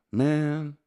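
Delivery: high, put-on American comedian's voice; Reg. Comedian asking his friend about last night's joint, high impression. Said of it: "I'll do the voice".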